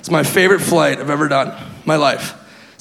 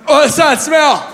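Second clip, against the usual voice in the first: high, slow, groggy outburst; half-asleep blurt; 9 semitones higher, much slower than usual. Acted. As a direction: blurt it out as if barely awake. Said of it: "when you blurt shit out in, like, a half sleep".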